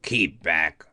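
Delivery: low, rough warning cry; low gruff hammerhead guard. Acted. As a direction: bark it out from behind a rock. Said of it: "they heard a rough voice cry out".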